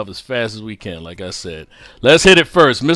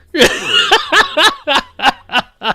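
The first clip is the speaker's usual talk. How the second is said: high laugh; 4 dB louder than usual. Real, not acted. Laughing at the ad memory.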